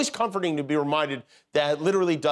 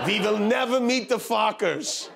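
put-on slow, higher-pitched Russian accent; 6 semitones higher, slower than usual. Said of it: "that was my Russian accent".

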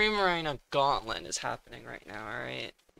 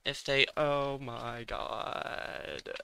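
ordinary talk, nothing marked

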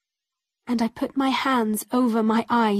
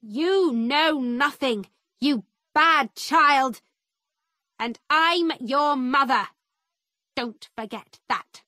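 angry shout; 3 semitones higher than usual. A narrator's reading. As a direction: lash out at your child, then drop on the last words. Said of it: "shouted at me".

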